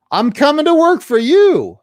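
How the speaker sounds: high, loud imitation of a friend; emphatic announcement.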